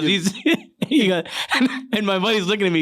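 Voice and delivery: ordinary delivery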